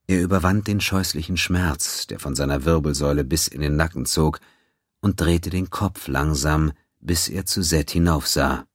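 usual speaking voice

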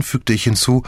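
ordinary delivery, nothing marked

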